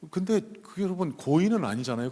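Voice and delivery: usual speaking voice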